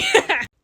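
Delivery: short, high, loud laugh; high cackling laugh. Real, not acted. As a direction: burst out laughing at your own joke. Laughs after own sly joke.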